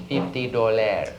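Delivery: put-on slow French-accented price quote; mock French accent. Parisian girl names her price to a tourist, French accent.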